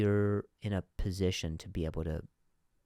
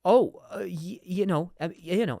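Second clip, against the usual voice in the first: high, loud flustered stammer; acting a boss caught off guard; thrown off; 9 semitones higher, 6 dB louder than usual. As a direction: stumble over the words, pitched up. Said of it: "he'll be thrown off".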